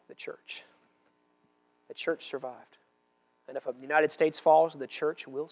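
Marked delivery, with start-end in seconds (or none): 0.1-2.8 hushed whisper. Acted. Whispered punchline in sermon.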